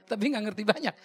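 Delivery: usual speaking voice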